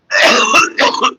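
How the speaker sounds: harsh coughing after a hit; coughing fit. Real, not acted. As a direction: cough hard from smoke.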